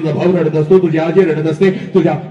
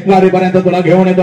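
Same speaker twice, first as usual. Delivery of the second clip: ordinary talk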